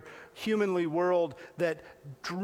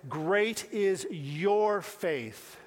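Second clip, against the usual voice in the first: Great is your faith drawn out very slowly, each word weighted; slow emphatic praise; much slower than usual. Shown as a demonstration. Preacher repeating Jesus' praise to the congregation, slow and weighty. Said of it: "And here Jesus says".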